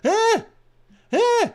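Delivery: very high, very loud screech of shock; real high-pitched startled yelling; startled shriek. Real, not acted. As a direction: shriek in alarm at sudden intruders. Startled by a surprise stream raid.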